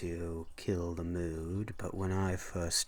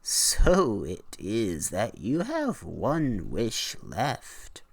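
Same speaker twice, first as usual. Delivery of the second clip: hag declaring, louder; 2 semitones higher, 6 dB louder than usual. A narrator's reading. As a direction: lean into it like an ominous hag. Hag voiced in story.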